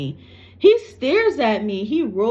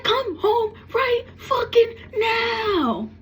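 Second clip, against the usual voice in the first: slow, sobbing yell of a desperate mother; crying shout; 14 semitones higher, much slower than usual. Retold or imitated. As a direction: scream it through tears, dragging each word. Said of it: "She's like".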